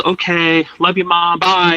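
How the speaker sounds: put-on chirpy send-off to the old lady; cheerful goodbye. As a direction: wave her off brightly with a lifted pitch. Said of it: "and my mom says".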